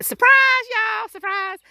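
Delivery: spring it on them, high and bright; cheery high surprise shout; playful surprise call. Real, not acted. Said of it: "one of my favorite things to say".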